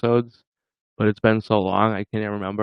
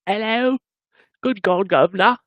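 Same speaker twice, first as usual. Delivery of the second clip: hammed-up, high British greeting; silly British caricature, pitched way up; mock British accent; 9 semitones higher than usual. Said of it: "Is that Britain?".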